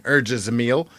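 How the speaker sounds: ordinary delivery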